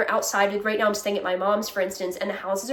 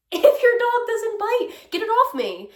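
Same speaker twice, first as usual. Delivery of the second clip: mock outburst; 12 semitones higher, 5 dB louder than usual. Mock outburst at dog owner.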